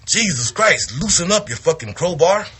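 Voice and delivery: griping at a stiff partner in the ring; retold bitching. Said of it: "he started bitching".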